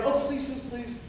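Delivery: hammed-up pleading plea; mock begging. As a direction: beg and wheedle like a manipulator. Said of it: "they'll use begging, pleading".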